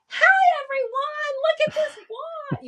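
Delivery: very high, slow, sing-song look-at-me voice; hammed-up attention-seeking call; mock showy announcement. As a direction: parade the wand like a show-off. Mocking showy attention seeker.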